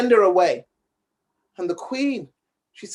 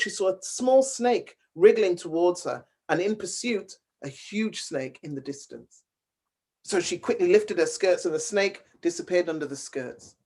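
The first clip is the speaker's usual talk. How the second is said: plain reading voice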